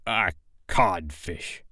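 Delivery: soft, slow, stunned repeat; hushed, dumbfounded captain; blank echo. A narrator's reading. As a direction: echo it quietly and emptily. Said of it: "Hook echoed blankly".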